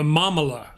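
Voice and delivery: MAMALA said carefully as a rhyme cue; Mamala, spoken as a model word; pronunciation demo. Shown as a demonstration. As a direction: show how Mamala sounds, stress on the first syllable. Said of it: "Rhymes with com".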